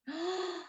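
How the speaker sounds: soft, quiet gasp of wonder; delighted gasp. Acted. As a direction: gasp as if amazed by the bow. Perfect bow appears, reading to kids.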